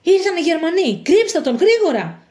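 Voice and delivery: urgent, high-pitched alarm; alarmed warning. Acted. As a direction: warn them of the danger at the door. Warning that soldiers have come.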